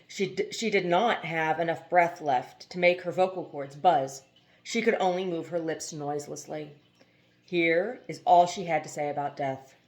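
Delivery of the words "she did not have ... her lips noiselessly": plain reading voice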